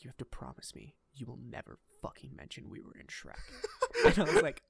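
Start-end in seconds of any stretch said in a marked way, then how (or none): 0.0-3.4 imitating a whispered, urgent demand; hushed secret plea. Friend's secret plea retold.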